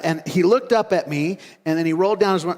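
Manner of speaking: usual speaking voice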